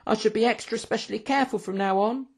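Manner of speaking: put-on high-pitched, earnest young waiter; insistent waiter. A narrator's reading. Waiter reassuring his angry boss, insistent. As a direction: promise it eagerly in a high voice. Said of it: "insisted Hectus".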